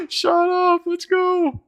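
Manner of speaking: very high, squealed glee; giddy excitement. Real, not acted. Streamer giddy after friend's text, high squeal. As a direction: squeal it straight out of laughter.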